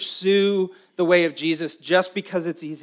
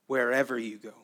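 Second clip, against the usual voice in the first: softly; 4 semitones lower, 6 dB quieter, faster than usual. Shown as a demonstration.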